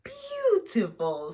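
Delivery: slow, high praise; drawn-out teacher praise; praising teacher. Acted. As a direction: savour the word as you admire their work. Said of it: "says the teacher".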